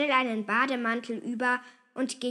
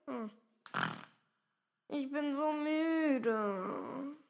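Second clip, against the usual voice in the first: soft, very slow, drawn-out whine; exhausted, yawny complaint; very tired; 4 semitones higher, 5 dB quieter, much slower than usual. A narrator's reading. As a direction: moan it sleepily. Sleepy sister complains.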